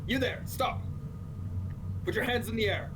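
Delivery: high-pitched guard's command; halting order. Acted. Leather fighter brandishing his knife at a stranger, commanding.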